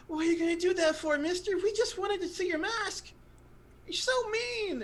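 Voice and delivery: put-on high, hurt child voices; pouty kids scolding the stranger; whiny gnome kids. Gnome kids complain to the minotaur, high and whiny. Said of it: "the two kids say".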